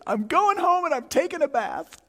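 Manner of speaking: high, loud mock-crying voice; tearful outburst. Crying wife quits on husband, high tearful wail. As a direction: wail it like a wife who's had enough. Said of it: "She's crying".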